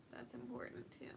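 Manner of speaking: breathy aside whisper. Real, not acted. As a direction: drop to a whisper.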